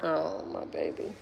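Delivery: soft, low and slow comfort; tender comfort. Real, not acted. Mother comforting hurt daughter.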